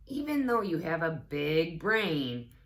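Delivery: ordinary delivery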